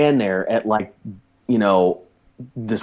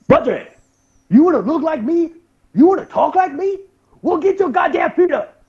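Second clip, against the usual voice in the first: very high, strained character voice; sergeant impression. Sergeant confronting trainee who mimicked him, high strained impression. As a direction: challenge them in spaced-out phrases. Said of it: "I did a pretty good impression of my fucking, uh, sergeant".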